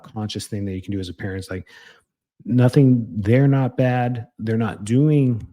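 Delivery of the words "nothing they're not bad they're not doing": slow emphasis. Shown as a demonstration. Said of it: "slow emphatic teaching".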